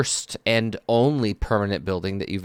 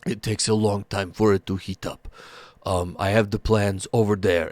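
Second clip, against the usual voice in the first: gentle ogre smith. Acted.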